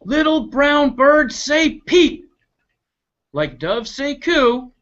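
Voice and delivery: high, slow preachy bird voice; mild sing-song scolding; sing-song dove. Acted. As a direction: chant the rule like a prim dove. Dove lecturing in rhyme.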